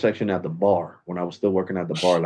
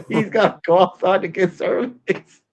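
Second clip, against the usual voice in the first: laughing; 12 semitones higher, 6 dB louder, slower than usual. Retold or imitated. Laughing retelling of sweaty guy.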